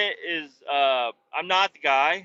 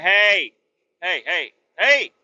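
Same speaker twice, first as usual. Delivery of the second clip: loud hey; 6 dB louder, slower than usual. Retold or imitated. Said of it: "He's gonna be like".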